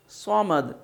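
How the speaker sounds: "صمد" with a heavy, rounded s; thick saad. Shown as a demonstration. Teacher repeating the correct word, thickened saad. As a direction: say "صمد" as "sawmad", tongue drawn back. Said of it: "صاد سے ادا کرنا ہے".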